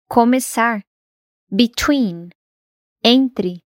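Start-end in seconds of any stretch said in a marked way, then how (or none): 1.5-2.3 "Between" with -TWEEN stressed, be- weak; stress on second syllable. Shown as a demonstration. Teacher models preposition "Between", stress on TWEEN. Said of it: "o stress geralmente tá na segunda sílaba".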